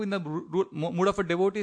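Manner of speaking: ordinary talk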